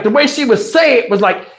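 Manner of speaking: ordinary talk, nothing marked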